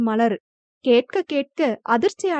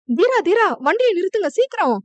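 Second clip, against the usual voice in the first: urgent shout; 7 semitones higher than usual. A narrator's reading. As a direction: yell for him to stop now. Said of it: "எதுக்கு இப்படி கத்துற?".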